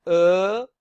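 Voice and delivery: Er held long and loud; French E as a drawn-out "euh"; "er" sound. Shown as a demonstration. Teacher demonstrates the Er sound for learners, held and loud. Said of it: "have the Er sound".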